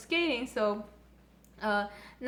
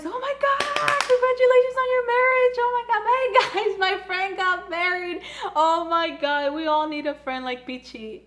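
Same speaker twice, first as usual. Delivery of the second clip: much higher, louder, squealing congratulations; gushing excitement; 8 semitones higher, 6 dB louder than usual. Retold or imitated. Peachy congratulating the couple on their marriage, squealing and loud. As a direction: squeal it out like a thrilled best friend.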